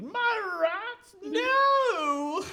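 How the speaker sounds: very high, loud melodramatic shriek; Rat King wailing. Acted. Rat King turns on the player who punched his rats, shrieking wail. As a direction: wail in hammed-up horror for your beloved rats. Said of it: "he kinda turns to you".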